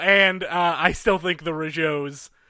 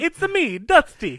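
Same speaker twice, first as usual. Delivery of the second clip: Mario voice; 9 semitones higher than usual. Pretend. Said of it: "Trying to do the Italian accent with a lisp".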